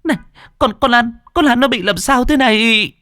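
high, stammering cry for help; panicked plea. A narrator's reading. Terrified girl asks neighbours about her friend, panicked plea. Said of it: "nó hốt hoảng cầu cứu".

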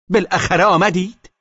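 wife snaps at her late husband; angry shout. A narrator's reading. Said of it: "زن با عصبانیت فریاد کشید".

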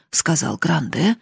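ordinary delivery

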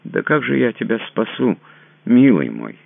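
pitying exclaim of real regret; sincere regret. Acted. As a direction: cry out in sincere sorrow. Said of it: "с искренним сожалением воскликнула".

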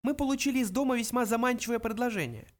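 high, small talkative mouse; mouse explaining. Acted. Mouse tells Arthur they are fed up, high voice. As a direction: explain wearily in a little high voice.

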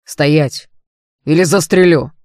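loud threatening shout; shouted command. A narrator's reading. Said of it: "крикнул он".

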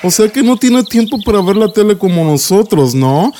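lightly voiced teasing children; a touch of a jeering kid; mocking kids. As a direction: neighbour kids making fun of David. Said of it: "niños ... decían".

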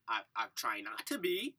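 low, soft grumble; reluctant sleepy reply. Retold or imitated. Half-asleep reply to the neighbors, low and reluctant. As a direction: answer quietly, wishing to be left alone.

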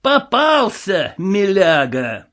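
drawn-out, menacing gloating; sinister. A narrator's reading. Villain who has caught his prey, sinister. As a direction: draw it out slowly with relish, ominously. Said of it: "зловеще процедил он".

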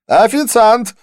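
high, loud call for the waiter; sharp high shout to summon service. A narrator's reading. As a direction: yell across a noisy bar. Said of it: "крикнул Дидье".

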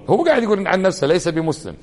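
ordinary delivery

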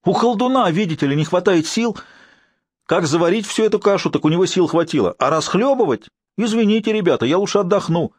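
sarcastic, annoyed tirade. Acted. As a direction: sneer at the wizard's weakness. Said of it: "опять повысил голос Борис".

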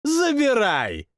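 high, slow contemptuous command; dismissive. Acted. King dismissively hands over the outworlder, high and scornful. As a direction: wave her off with scorn. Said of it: "пренебрежительно махнул рукой".

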